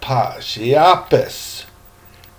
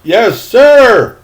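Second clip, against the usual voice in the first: higher, louder "Yes, sir"; dutiful boy; 8 semitones higher, 7 dB louder than usual. Acted. Young Trey answering the old seaman, high and eager. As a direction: answer like an obedient kid.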